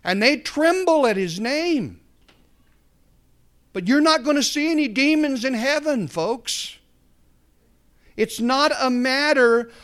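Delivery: high, loud preaching climb; full-force preaching. Real, not acted.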